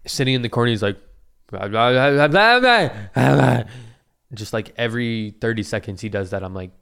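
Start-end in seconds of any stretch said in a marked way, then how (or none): 1.5-3.6 a hint of a rambling old man, rising in pitch; mock old man babbling. Imitating the bearded old man in the waiting room, babbling. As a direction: babble like the old guy in the corner.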